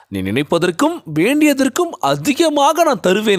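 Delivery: high, emphatic declamation; ringing, lifted preacher voice; God's promise. Acted. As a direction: proclaim it as God's own word.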